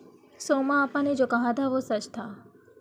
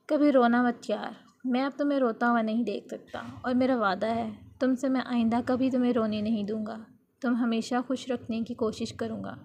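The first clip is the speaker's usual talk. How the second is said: plain reading voice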